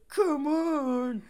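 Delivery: very high, drawn-out holler; mock frantic yell. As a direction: urge him on in a hammed-up cry. Acting out man pushing another down.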